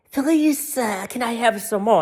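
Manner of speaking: high pleading orphan voice, lightly done; mock-beg like a workhouse boy; mock Oliver Twist beggar. Mocking a politician as a begging orphan. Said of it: "the king and Oliver Twist".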